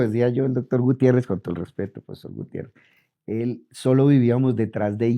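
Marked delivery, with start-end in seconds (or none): 1.3-2.7 breathy whispered name, half to himself; a hushed, deferential whisper; whispered aside. Real, not acted. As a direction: whisper it as a respectful afterthought. Respectful aside after joking about boss.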